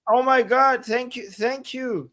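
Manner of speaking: high, gushing oh my God and thank yous; overwhelmed by a long-time supporter's loyalty; thrilled gratitude. Real, not acted. Co-host thanking a loyal follower, high and thrilled.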